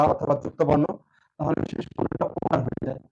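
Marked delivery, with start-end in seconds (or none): none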